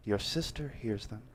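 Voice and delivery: ordinary delivery, nothing marked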